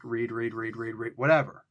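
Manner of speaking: low, droning monotone repetition; monotone reading. Acted. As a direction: drone the words out all the same. Mimes dull unbolded reading.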